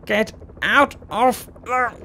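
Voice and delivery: high, slowed shout; rising angry shout; angry yell. Real, not acted. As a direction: yell at them to clear the way. Yelling at blocking riders.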